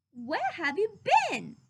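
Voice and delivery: put-on a curious child asking; young boy voice. Christopher Robin voiced.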